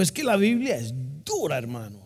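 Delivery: playful Panamanian impression with a sing-song swoop; Panamanian accent. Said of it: "Un panameño me dijo".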